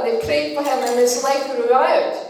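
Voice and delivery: normal talking voice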